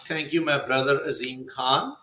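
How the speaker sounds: usual speaking voice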